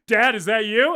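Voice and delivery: hammed-up puzzled son; worried son calling out. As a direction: call through the house for dad, playing it up. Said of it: "and he's like".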